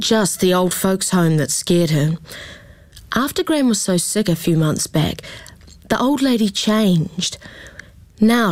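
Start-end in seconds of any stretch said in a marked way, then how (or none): none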